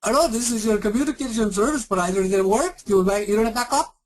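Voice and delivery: high put-on Eastern European accent; a mock provider calling back in an Eastern European accent; hammed-up Eastern European accent, high; bad Eastern European accent. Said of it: "really bad Indian and Eastern European accent".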